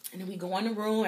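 ordinary talk

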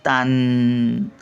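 "तां-," slow, held on the "n"; अनुस्वार लांबवून. Shown as a demonstration. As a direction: stop on a long humming taan. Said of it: "तावर". ("तां-," नासिक्य ध्वनी लांबवून)

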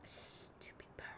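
whispered grumble; whispered annoyed aside. Real, not acted. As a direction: hiss it under the breath. Whispered insult.

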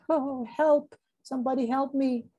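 high-pitched plea for help; frightened. A narrator's reading. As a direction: cry out high like a scared little character. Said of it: "Keeper was frightened".

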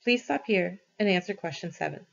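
usual speaking voice

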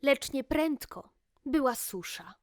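ordinary delivery, nothing marked

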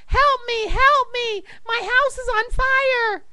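very high, loud mock panic; hammed-up frantic yell; yelling for help. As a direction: shriek for rescue, playing it up. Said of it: "Again the blonde yelled".

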